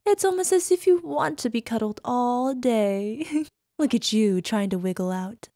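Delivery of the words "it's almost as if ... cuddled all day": ordinary talk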